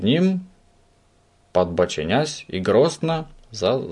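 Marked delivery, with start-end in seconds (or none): none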